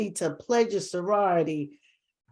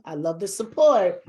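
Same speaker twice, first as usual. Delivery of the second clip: ordinary talk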